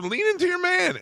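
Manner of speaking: teasing retold command; mock scolding. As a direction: boss her around playfully. Warren retold scolding the woman.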